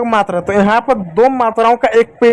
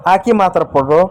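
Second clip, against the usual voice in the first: normal talking voice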